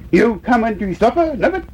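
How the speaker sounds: put-on high-pitched call to a child. A narrator's reading. Mother calling her young mouse in to supper, calling. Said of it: "called Missus Nubbet".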